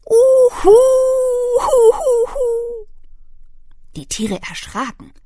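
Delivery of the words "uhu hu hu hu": hohes, lautes Eulenheulen; schreckliches Heulen. Acted. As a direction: heul wie eine kleine Eule, ganz hoch. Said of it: "ein schreckliches Heulen". (hohes klagendes Uhu-Heulen)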